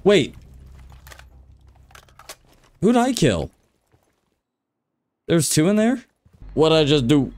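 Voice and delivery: high, startled confusion; surprised. Real, not acted. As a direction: caught off guard, voice jumping up. Confused kill mid-round.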